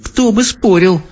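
put-on high agreeable girl voice; girl agreeing. A narrator's reading. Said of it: "согласилась Софи". (детский голос, соглашаясь)

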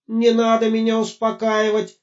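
high desperate robot shout. Acted. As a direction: yell it out in despair. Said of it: "закричал Гоша".

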